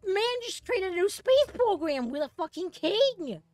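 high, loud, stretched-out exclamation; amazed outburst. Real, not acted. Gamer marvels at king's new space program, yelling in amazement. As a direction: crack up high in real astonishment.

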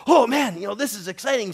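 team member's excited exclamation, loud and high on Oh man; gushing like an eager teammate; excited quote. Retold or imitated. Teammate arriving early at church, mock excited. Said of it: "the guys who are coming with me and say".

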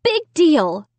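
high-pitched dismissive retort; muttered retort. A narrator's reading. Child brushing off the dummy's threat, muttered retort. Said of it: "I muttered".

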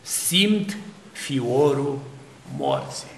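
slow, hushed and fading, a dying man feeling death; dying man's hushed words. Retold or imitated. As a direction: let the words sink away slowly, as if feeling death's chill. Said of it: "Ce spuneau uneori unii oameni".

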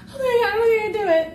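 ordinary talk

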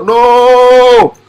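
high, held shout of refusal; emphatic no. Real, not acted. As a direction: cry out a drawn-out rejection. Loudly rejecting a claim he quotes.

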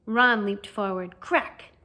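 usual speaking voice

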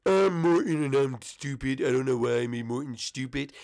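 slow, stupid oaf voice; Morton. Shown as a demonstration. Morton admits he can't do his alphabet, stupid voice. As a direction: drag the words out like a dimwit. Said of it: "uh, stupid".

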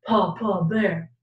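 put-on low bear voice for children; deep-pitched demonstration of a father bear; Papa Bear voice. Shown as a demonstration. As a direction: drop down low like a big bear. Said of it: "Let's practice our bears".

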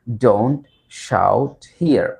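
"Don't", pause, then "shout here" spaced out; teacher's slow-release demonstration of "Don't shout here"; word by word. Shown as a demonstration.

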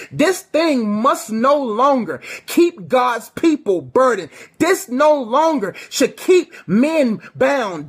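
rally the church like an army; fiery preacher shouting to the congregation; preaching at full force. Acted.

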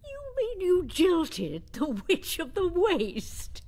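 slow, loud disbelief; stunned, spell it out slowly; aghast, drawn-out question; shocked. Acted. Sophie shocked at jilting news.

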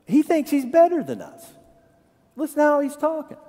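high-pitched mock resentment; put-on indignant gossip; offended townsfolk. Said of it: "they got offended about him".

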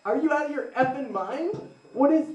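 stunned, rising disbelief; incredulous, hammed-up; mock outrage. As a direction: sputter like you can't believe it. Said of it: "I was like".

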